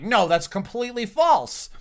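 high, drawn-out mock rebuttal; slow, high imitation of an indignant denial; imitated captain's denial. Retold captain rejects the claim.

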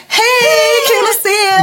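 high, excited hello; gushing greeting. Retold or imitated. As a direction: burst out with a high-pitched greeting. Runs out to greet onstage.

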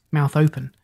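plain reading voice

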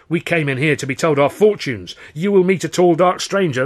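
heated protest, pitch climbing; telling off. A narrator's reading. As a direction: scold with mounting indignation. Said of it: "a right telling off".

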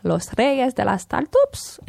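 put-on "Los Reyes de las Startups" in a light Spanish accent; a hint of Spanish accent. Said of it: "pardon pour mon accent".

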